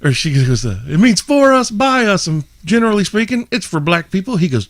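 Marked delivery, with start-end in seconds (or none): 0.9-4.4 high falsetto explaining; counter girl. Retold or imitated. The counter girl explains the brand in a high falsetto.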